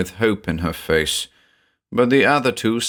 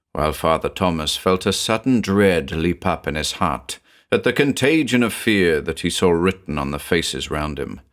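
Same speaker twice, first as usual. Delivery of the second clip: plain reading voice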